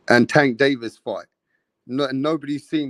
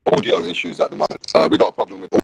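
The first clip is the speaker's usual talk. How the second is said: glitchy robot-like bad connection; choppy distorted robotic audio; 6 semitones lower, faster than usual. Real, not acted. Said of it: "with this robotic voice".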